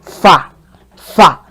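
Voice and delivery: "Fa" said on a low, dropping tone. Shown as a demonstration. Teacher modelling the low do tone for learners. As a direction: drop the voice low on "fa". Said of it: "Your voice has to drop".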